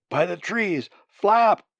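loud, high, desperate order; cried aloud. A narrator's reading. Wounded bird orders numb wing to move, crying aloud. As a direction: cry out a command to your own wing. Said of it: "he cried aloud".

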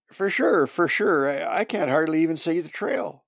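high, loud, stammering agreement; worried hunter. Acted. Hunter can't see trail in blizzard, worried. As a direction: agree urgently over the wind.

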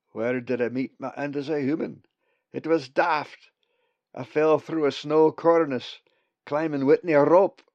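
broad friendly Scots voice; scottish accent. Acted. Bugs the raven speaks.

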